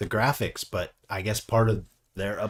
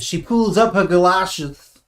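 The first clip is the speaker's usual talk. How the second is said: put-on Scottish accent; 9 semitones higher, 8 dB louder than usual. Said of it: "in a Scottish accent".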